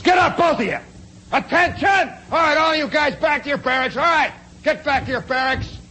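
loud, high military shout; officer's command. Retold or imitated. Officer breaking up fight, shouted military orders. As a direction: order the troops away at full voice.

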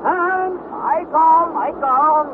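Hans calls down from high on the mast; a servant's call from far above, high. Acted.

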